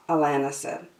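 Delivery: ordinary delivery, nothing marked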